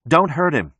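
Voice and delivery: high, urgent caution. A narrator's reading. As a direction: call a caution to the others. Bystander warns men handling the captive.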